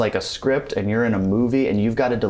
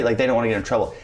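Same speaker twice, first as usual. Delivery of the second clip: usual speaking voice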